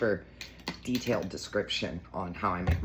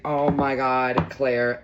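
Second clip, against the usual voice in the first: loud, slow, delighted outcry; thrilled yell over a precious mug; excited scream; 2 semitones higher, 11 dB louder, much slower than usual. Retold or imitated. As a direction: shout it like you just saw the perfect gift. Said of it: "I screamed".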